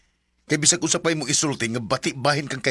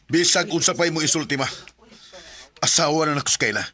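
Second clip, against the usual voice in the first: louder, higher heated defiance; angry retort; 6 semitones higher, 4 dB louder than usual. Acted. Robert defending his wife to mother, heated. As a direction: stand your ground, voice rising. Son shouts back at mother.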